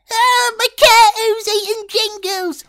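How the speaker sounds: very high squeaky panicked voice; Stranger Things impression. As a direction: squeal it like a frightened kid. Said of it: "give us a Stranger Things impression".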